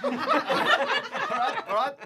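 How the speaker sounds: high, loud burst of laughter; laughing. Real, not acted. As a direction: laugh through the words. Laughing at bad roll.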